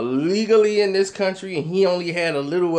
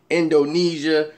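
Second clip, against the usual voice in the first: ordinary talk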